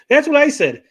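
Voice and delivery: loud, high-pitched exclamation; emphatic agreement. Real, not acted. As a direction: burst out in vindicated agreement. Loud agreement about the debate.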